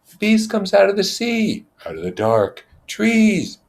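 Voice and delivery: put-on high, eerie boyish voice; Simon's uneasy voice. Simon telling the assembly where the beast comes from, high and uneasy. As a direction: say it like a strange warning.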